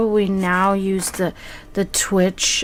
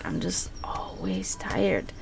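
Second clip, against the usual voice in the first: breathy whisper, slower; whispered aside; 10 dB quieter, slower than usual. Real, not acted. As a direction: drop to a tired whisper. Tired aside to herself.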